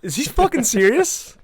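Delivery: high and loud, slow disbelief; real high-pitched amazement; incredulous outburst. Real, not acted. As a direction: cannot believe what he is hearing.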